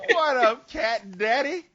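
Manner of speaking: high, rising jive-talk hail; seventies jive. Acted. Sugar Man greets a pal, seventies jive voice. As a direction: lift it up like a seventies hustler. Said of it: "sounds like something from the seventies".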